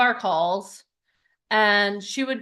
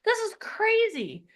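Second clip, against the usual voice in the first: retold amazement; 7 semitones higher, slower than usual. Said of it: "I'm like".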